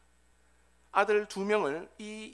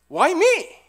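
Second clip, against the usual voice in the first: loud, high cry of protest; anguished outcry; 11 semitones higher, 14 dB louder than usual. Acted. Father who lost his eldest son crying to God, anguished shout. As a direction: hurl the question up at God. Bereaved man cries out to God.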